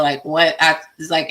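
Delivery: ordinary talk, nothing marked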